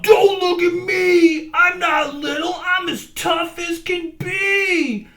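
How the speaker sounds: high, loud defiant shout; tiny buffalo shouting back at the crowd. A narrator's reading. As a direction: yell it to hide the hurt. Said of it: "Fluff shouted out".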